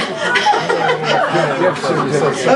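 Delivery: a long laugh, can't stop; sheepish laughter; embarrassed laugh. Real, not acted. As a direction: laugh it off after a flub. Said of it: "I really messed that up".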